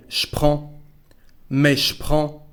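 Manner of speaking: "Je prends" said sh-pran; je becomes sh. Shown as a demonstration. Teacher modelling for learners, "je prends" said as sh-pran. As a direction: replace "je" with a bare sh. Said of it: "the sound sh to replace je".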